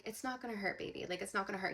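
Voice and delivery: ordinary delivery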